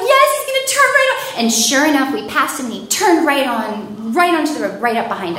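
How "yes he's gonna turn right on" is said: high, loud frantic scream; panicked yell. Retold or imitated. Panicked answer to operator.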